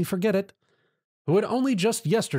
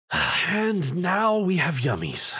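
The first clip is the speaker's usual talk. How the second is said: slow, weary groan; 5 semitones higher, much slower than usual. A narrator's reading. Tom groans as monsters form from the medals, slow and weary. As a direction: drag it out, fed up. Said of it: "Tom groaned".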